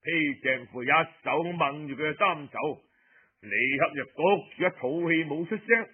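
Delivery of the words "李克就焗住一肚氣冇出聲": ordinary delivery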